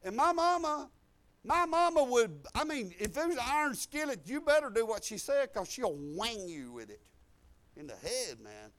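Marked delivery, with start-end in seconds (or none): 0.0-7.0 raised, higher-pitched preacher's delivery; emphatic preaching. Acted. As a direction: push it out loud like a warning from the pulpit. Preaches about his tough mama.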